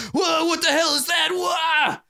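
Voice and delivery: startled shout. Acted. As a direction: yelp and recoil at the cat. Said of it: "slithers back in surprise".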